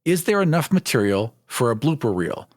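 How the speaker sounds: usual speaking voice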